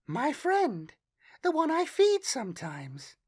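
put-on high, dreamy child voice; a strange girl sharing a secret; eerie little girl. A narrator's reading. Girl explains her imaginary friend.

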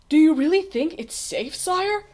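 worried question. A narrator's reading. Page boy questions the king's safety.

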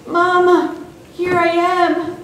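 high, loud call back; strained calling answer. A narrator's reading. Stranded boy answers his mother's call, high urgent shout. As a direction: shout back with all your strength. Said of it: "mustered all his strength to answer".

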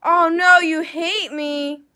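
loud, high mock shock; mock dismay. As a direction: gasp it out like it's a tragedy. Streamer reacts to the hate.

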